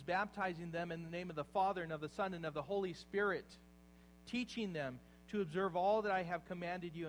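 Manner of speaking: loud, ringing scripture quote from the pulpit; preaching at full force. Acted. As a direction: proclaim the command to the whole church. Preacher quoting the Great Commission.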